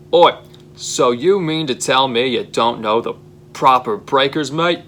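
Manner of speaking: put-on angry Australian accent, rising high on mate; vicious Australian yell. Australian boss yelling at his nervous employee, fierce Australian accent. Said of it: "Piper yells in a vicious Australian accent".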